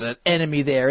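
usual speaking voice

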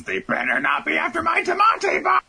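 high, loud, furious screech; angry shout. Retold or imitated. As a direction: scream it like a raging queen. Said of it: "she gets really pissed off".

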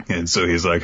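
normal talking voice

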